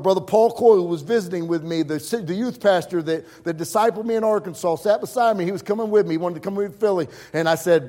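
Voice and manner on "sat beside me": ordinary talk, nothing marked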